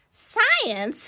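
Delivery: high-pitched, indignant question; shrieky-high put-out exclamation; fussing. Acted. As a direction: fuss in disbelief. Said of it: "fussed the queen".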